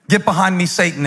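usual speaking voice

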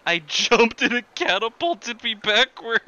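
ordinary talk

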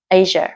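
"Asia" as AY-zha, two syllables. Shown as a demonstration. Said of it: "Từ này chỉ có hai âm tiết thôi". ("Asia" chỉ hai âm tiết)

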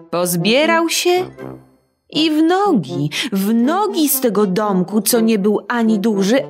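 plain reading voice

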